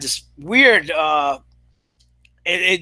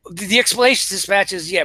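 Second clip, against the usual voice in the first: ordinary talk, nothing marked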